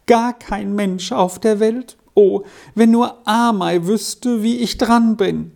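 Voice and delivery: high-pitched, plaintive lament; sighing despair. A narrator's reading. Boy longs for Amei to know his plight, despairing. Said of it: "seufzte Lauri manchmal auf".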